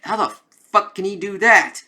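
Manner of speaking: excited mock disbelief; amazed outburst. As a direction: react like a stunned fan. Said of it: "I'm like".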